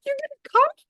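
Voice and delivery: very high, shocked outburst; indignant persecutor daughter. Acted. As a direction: turn on mom in disbelief. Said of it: "daughter immediately switches and goes to persecutor".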